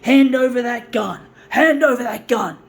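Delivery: loud, stern cop command; policeman order. Retold or imitated. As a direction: bark it like a policeman. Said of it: "Like he was a policeman saying".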